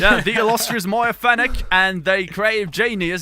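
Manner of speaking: slow, loud British announcer; British accent. Acted. Host introducing his co-hosts, grandly put-on British accent. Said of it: "we only get to talk British English".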